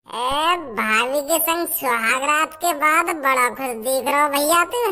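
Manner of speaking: slow, deep teasing jab; deep teasing man. Acted. Teasing the brother after his wedding night, deep and slow.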